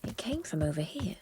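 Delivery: hushed whisper, voice unvoiced. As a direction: whisper it so nobody hears. Searching, speaks under breath.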